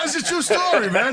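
high-pitched, insisting through laughter; cracking up, voice jumping up high; laughing protest. Real, not acted. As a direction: laugh while swearing the tale is true. Protests while others laugh at story.

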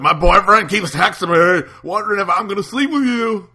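loud, high-pitched drunk girl impression; drunk Pat. Friend at the bar talking about her boyfriend, drunk Pat voice. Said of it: "It's like a drunk Pat".

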